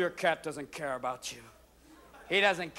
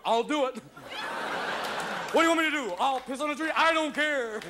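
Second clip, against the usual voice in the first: high, gung-ho dog voice; over-keen dopey dog; eager dog; 8 semitones higher than usual. Acted. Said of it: "It's always a dog going".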